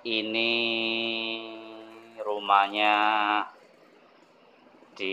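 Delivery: very slow, drawn-out, low and soft; slow talker. Shown as a demonstration. Salesman demonstrating a slow-talking house buyer, very slow drawl. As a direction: draw every word out with long pauses. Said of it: "Saya ngomongnya gini".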